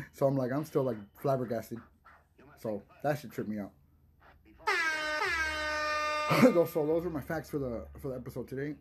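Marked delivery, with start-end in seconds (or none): 4.6-6.4 high giggle. Real, not acted. Laughing at own fact.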